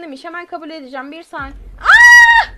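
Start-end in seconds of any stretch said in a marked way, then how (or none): 1.8-2.5 very loud high scream; piercing scream. Real, not acted. Gamer spots the chaser, piercing scream. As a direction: scream as the killer appears.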